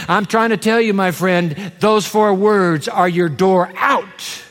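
normal talking voice

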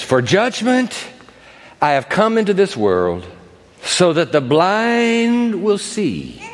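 slow, weighty reading with key words stretched out; drawn-out, deliberate pulpit recitation; solemn scripture reading. Acted. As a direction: proclaim the verse slowly for emphasis. Reads scripture aloud.

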